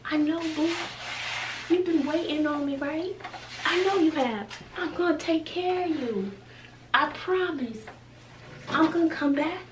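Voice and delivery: put-on soft apologetic coo to an old friend; tender coo to the treadmill. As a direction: sweet-talk it like a neglected sweetheart. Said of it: "I just look at it like".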